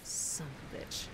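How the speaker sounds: whispered swear under the breath; muttered curse. Real, not acted. Gamer mutters at the dragon, whispered curse.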